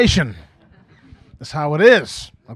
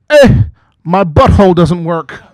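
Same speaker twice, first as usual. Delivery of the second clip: loud mock complaint; 8 dB louder than usual. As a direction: wail it like an indignant secretary. Misheard secretary quoted.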